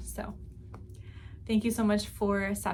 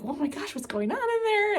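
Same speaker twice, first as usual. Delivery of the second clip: high-pitched mock concern; imitating alarmed relatives; 12 semitones higher than usual. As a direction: voice the worried family outside the door. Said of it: "And everyone's like".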